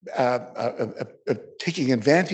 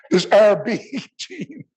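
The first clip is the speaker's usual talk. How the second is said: choked up; 6 semitones higher, 7 dB louder, much slower than usual. Real, not acted. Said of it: "I'm getting choked up here".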